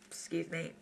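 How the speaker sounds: ordinary delivery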